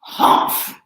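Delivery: breathy dismissive huff; scornful humph. A narrator's reading. Kangaroo scoffing at the elephant. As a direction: scoff through the nose. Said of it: "humped a voice".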